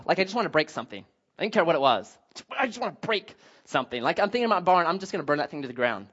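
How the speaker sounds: normal talking voice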